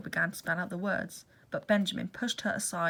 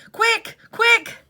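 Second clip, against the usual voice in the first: urgent high shout, much louder; 13 dB louder than usual. Acted. Benjamin calling the animals from work, loud urgent shout. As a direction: yell to call the others running. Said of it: "he shouted".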